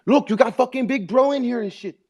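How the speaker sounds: put-on high comic Mexican accent; hammed-up Mexican-accented heavy; Mexican tough guy. Plays Mexican tough relative.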